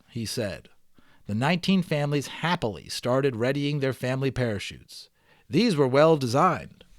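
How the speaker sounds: steady read-aloud storytelling voice; story narration. Acted. Teacher reading the balloon novel aloud to students, storytelling narration. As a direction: read it like a chapter book to the class.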